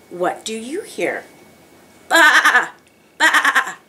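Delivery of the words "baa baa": high bleating "baa", broken into pulses; sheep bleat. Pretend. Teacher giving children a farm sound to guess, sheep bleat. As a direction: imitate a sheep, twice. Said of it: "I hear a sheep".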